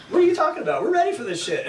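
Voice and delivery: high, loud incredulous voice; pumped friend. Retold or imitated. Surf partner hyped about the forty-foot swell, high loud voice. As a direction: fire it back, amped for the swell. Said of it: "And he's like".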